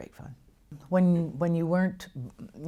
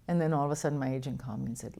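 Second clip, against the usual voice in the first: ordinary talk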